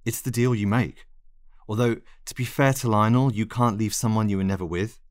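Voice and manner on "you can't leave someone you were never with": usual speaking voice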